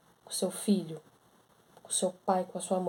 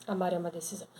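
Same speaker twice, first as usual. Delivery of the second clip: repeated much softer, almost to herself; quiet repeat; 2 semitones lower, 9 dB quieter, much faster than usual. Shown as a demonstration. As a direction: let the line drop to a soft echo. (repete baixinho, quase para si)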